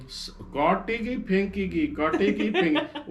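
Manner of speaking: high, laughing mimicry of his wife; mock her words through laughter.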